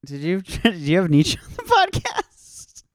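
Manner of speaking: high squeaky giggling question; laughing mock question. Host jokes about a Nietzsche guest, high and laughing.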